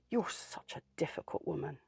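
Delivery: soft, unvoiced sneering whisper; whispered jibe. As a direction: hiss it out like a muttered insult. Manager voiced by speaker.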